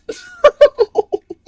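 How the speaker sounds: loud, very high laugh; explosive squealing laugh; cracking up. Real, not acted. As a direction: burst out in big high laughter. Laughs at the absurd phrase.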